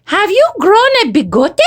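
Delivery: high little pup's question; Poquito Tito. A narrator's reading. Smallest dog eyeing the mustache, high curious voice. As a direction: ask it high with a Spanish lilt. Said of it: "declared Poquito Tito".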